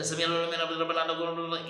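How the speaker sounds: mock careless fast mumble; rushed wrong way. Shown as a demonstration. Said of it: "اسی طرح نہیں پڑھنا". (جلدی جلدی غلط تلفظ)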